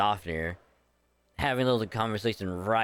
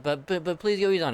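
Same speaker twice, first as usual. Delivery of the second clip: higher, louder, fast whiny begging; whiny plea; 8 semitones higher, 4 dB louder, faster than usual. Pretend. Player begging the dragons to go easy, whiny and fast. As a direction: beg like a panicking kid. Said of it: "It's like".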